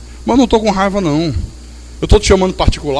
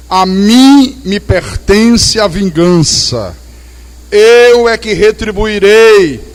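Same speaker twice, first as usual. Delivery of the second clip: slow, louder, ringing proclamation; slow commanding scripture voice; solemn scripture quote; 3 semitones higher, 5 dB louder, much slower than usual. Acted. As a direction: proclaim God's words slowly.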